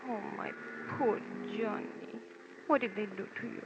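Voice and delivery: soft, low, pitying voice; tender concern. Acted. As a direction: comfort someone who has been hurt. Woman comforts the beaten man.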